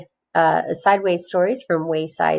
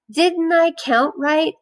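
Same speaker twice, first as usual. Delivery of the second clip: Joe puzzled; 10 semitones higher than usual. A narrator's reading. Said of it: "asked Joe".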